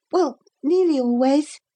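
put-on high-pitched boy backing down; sheepish boy. A narrator's reading. Boy correcting his boast, embarrassed. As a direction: admit it sheepishly as a small boy. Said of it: "hot to the ears".

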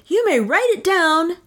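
high-pitched, pompous dictation; grandiloquent. A narrator's reading. Inventor dictates his discovery to the official, grandiloquent. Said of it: "said Pat grandiloquently".

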